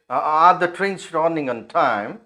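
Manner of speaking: slow, stretched question climbing high on "time"; rising tone. Shown as a demonstration. Teacher shows how falling sentences should not sound, slow rise on "time". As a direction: swing the pitch up on "time" as a contrast. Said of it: "It's not like".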